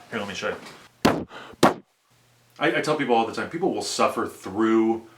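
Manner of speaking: usual speaking voice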